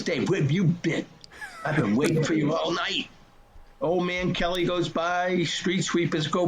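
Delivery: a hint of the grandfather, gently scolding; grandfather's voice. Grandfather on the phone to grandson, lightly voiced old man.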